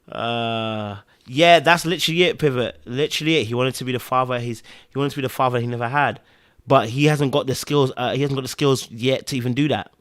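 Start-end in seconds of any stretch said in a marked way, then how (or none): none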